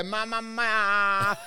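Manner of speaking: drawn-out slow calls of Ma; mock yelling out the window. Imitating himself calling Ma out of a car window. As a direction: stretch each Ma out like calling out a window. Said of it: "yelling out the window".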